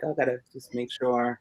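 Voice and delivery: hushed, cautious self-talk; wary inner voice. Retold or imitated. Man stepping outside, quietly reminding himself to be careful. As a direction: mutter it like checking your back. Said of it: "I'm like".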